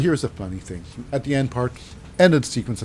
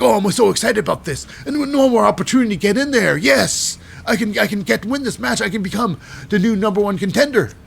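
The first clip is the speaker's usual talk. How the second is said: excited Nathan; 7 semitones higher than usual. Retold or imitated. Retelling Nathan backstage with Kelly, high giddy excitement. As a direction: squeal it out like an overeager kid. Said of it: "Nathan's like".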